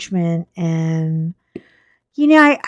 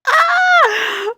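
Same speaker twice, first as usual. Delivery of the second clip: mock scream; 4 dB louder than usual. Reliving near-miss with a plane.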